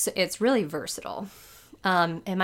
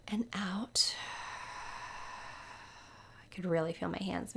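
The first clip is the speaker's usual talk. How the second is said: whisper; 10 dB quieter than usual. Acted. Guided breathing exercise, whispered cues.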